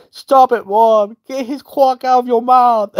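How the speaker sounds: high-pitched mock screaming; mock screech. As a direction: scream at mom in a high put-on voice. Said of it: "Lauren's like".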